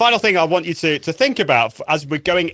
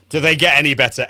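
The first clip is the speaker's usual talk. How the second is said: ordinary talk, nothing marked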